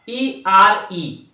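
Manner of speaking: teacher spelling "ई आर ई" very slowly. Shown as a demonstration. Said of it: "देख सुनिएगा".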